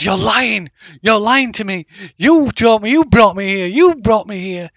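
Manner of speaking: put-on loud, high girl in a fury; angry screaming accusation. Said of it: "And she suddenly screams".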